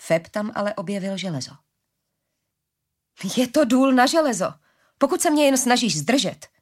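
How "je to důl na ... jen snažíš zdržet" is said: high, loud exasperated retort; losing patience. A narrator's reading. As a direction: snap at her for stalling. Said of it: "docházela Arile trpělivost". (rostoucí netrpělivost)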